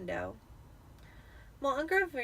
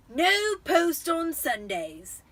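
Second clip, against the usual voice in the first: slow, loud, higher gloating uncle; cheerful Vernon; 7 semitones higher, 8 dB louder, much slower than usual. A narrator's reading. Vernon reminding the family, slow and gloating. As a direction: sing it out smugly. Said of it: "he reminded them cheerfully".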